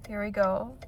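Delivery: ordinary talk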